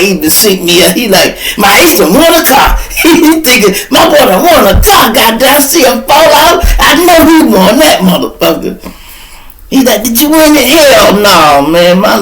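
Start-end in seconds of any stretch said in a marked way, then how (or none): 1.6-2.8 high, loud cheer; excited friend. Retold or imitated. His friend celebrates. 3.9-8.8 high, loud cheer; excited friend. Retold or imitated. Friend thinks he won. 10.0-10.7 high, loud cheer; excited friend. Retold or imitated. Friend asks.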